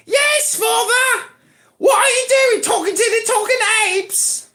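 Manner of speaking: high put-on English child voice; son impersonating father. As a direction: scold Daddy as a high-voiced little English boy. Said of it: "impersonate me".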